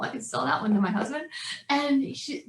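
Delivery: usual speaking voice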